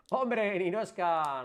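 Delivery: loud, high, drawn-out hello; delighted greeting. Real, not acted. Live streamer spots a regular viewer in chat, loud delighted greeting. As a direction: call out in happy surprise, stretching the words.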